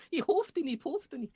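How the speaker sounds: very high mock German; hammed-up, squeaky German attempt; bad German. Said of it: "I can't do German very well".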